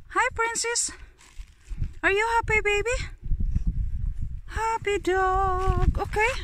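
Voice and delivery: high, cooing voice to a dog; doting pet talk. Real, not acted. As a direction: fuss over the happy dog. Fussing over the dog.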